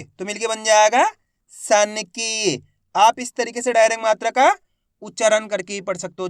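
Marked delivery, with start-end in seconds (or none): 1.5-2.6 सन की very slowly sounded out as one word; drawn-out long ई. Shown as a demonstration. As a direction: stretch की in सन की. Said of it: "खींच के पढ़ा जाता है".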